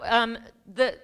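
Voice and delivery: ordinary talk, nothing marked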